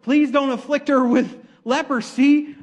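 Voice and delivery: high, loud mock plea; hammed-up desperate prayer; pleading brothers. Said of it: "her brothers".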